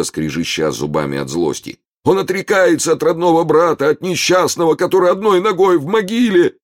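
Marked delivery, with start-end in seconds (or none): 2.0-6.5 высоким громким надрывным голосом; изобразить страдальца, выпрашивая пенни; притворный нищий в припадке. Pretend. Гуга продолжает жалобный обман. (высоким громким причитанием, как мнимый больной)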